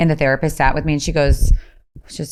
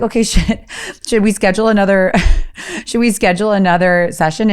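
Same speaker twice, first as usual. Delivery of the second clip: laughing while asking; 4 semitones higher than usual. Retold or imitated. Retelling asking therapist, laughing.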